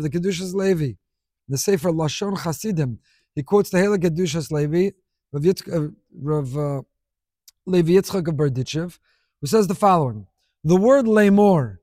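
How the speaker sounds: ordinary talk, nothing marked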